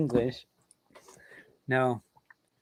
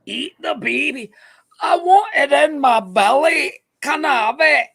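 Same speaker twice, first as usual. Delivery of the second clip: high, loud wailing Scottish character voice; hammed-up Scottish accent; 9 semitones higher, 4 dB louder than usual. Host clowning as a hungry Scottish character, hammed-up accent. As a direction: cry it out greedily in broad mock Scottish.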